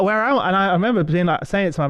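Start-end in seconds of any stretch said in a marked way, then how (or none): 0.0-0.5 high, stunned question; surprised. Retold or imitated. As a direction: ask it in disbelief. Shocked on the sales floor.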